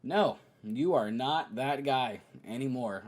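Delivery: ordinary talk, nothing marked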